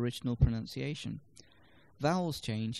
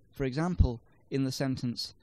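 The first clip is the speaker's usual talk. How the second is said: ordinary talk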